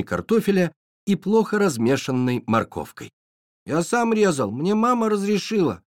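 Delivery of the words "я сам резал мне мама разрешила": put-on high squeaky little boy. A narrator's reading. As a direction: pipe it up like a proud small child. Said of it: "писклявым голосом". (писклявый детский голосок)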